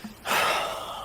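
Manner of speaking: long breathy exhale; resigned sigh. Acted. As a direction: let out a long sigh before answering. Reluctant before telling own story.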